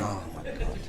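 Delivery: breathy under-the-breath oh my God; whispered exasperation. Real, not acted. Groaning aside to the room about the school run, whispered. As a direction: sigh it out, exasperated.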